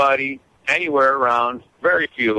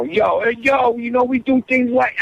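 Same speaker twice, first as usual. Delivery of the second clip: much higher, hammed-up Brooklyn accent; 13 semitones higher than usual. Guest mocking the Brooklyn talk he expected at the protest, hammed-up Brooklyn accent.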